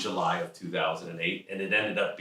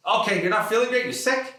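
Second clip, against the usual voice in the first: much higher, louder disbelieving dad; mock the father's disbelief; incredulous father; 14 semitones higher, 7 dB louder than usual. Father questioning son's sickness, high loud disbelief.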